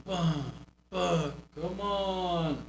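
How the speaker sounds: slow, limp, half-hearted taunt; weak half-hearted taunt. Shown as a demonstration. Coach showing wrestler the weak way, slow and limp. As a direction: mumble it like you don't care. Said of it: "It's not just".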